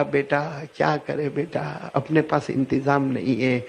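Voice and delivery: soft, slow, low sorrowful words; weeping mother. Acted. Mother softly and slowly grieving that she cannot send her son. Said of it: "اب ماں بھی روئی".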